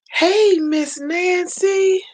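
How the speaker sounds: high, drawn-out welcome; excited greeting. Real, not acted. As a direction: sing out a hello to a friend arriving. Greets a viewer who joins.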